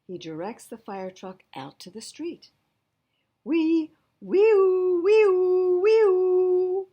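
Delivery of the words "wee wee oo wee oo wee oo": very high, loud wailing siren; siren imitation. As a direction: wail like a fire truck siren. Fire truck siren imitation.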